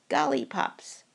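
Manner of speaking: hungry creature. Acted. Hungry thing names the food.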